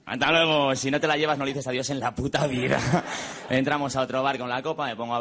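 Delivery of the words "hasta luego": loud mock farewell; calling goodbye. Clubber saying goodbye to the doorman, calling out loudly. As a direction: call out a hearty goodbye. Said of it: "te despides del portero".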